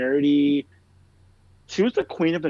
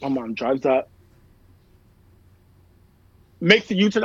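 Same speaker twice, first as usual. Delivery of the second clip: usual speaking voice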